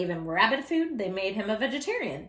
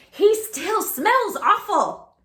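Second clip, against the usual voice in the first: high, loud, slow drawn-out disgust; disgusted mother; 10 semitones higher, 8 dB louder, much slower than usual. Acted. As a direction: stretch "smells" out like you can't stand it. Mother voiced in story.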